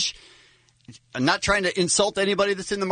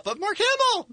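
high-pitched star-struck gush; excited. Real, not acted. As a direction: squeal the name. Excited about the celebrity.

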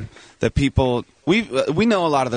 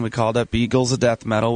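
ordinary talk, nothing marked

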